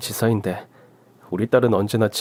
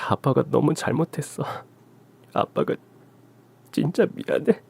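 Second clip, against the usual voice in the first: soft, choked-up apology, breaking up at the end; tearful apology; 3 semitones higher, 4 dB quieter than usual. Acted. Father apologizing to his daughter, close to tears. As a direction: hold back tears while saying sorry. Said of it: "아빠 울어?".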